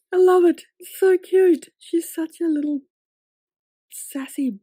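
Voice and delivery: high, loud squeal of adoration; gushing delight. Real, not acted. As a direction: gush over it, loud and high. Gushing over the cute unicorn scene.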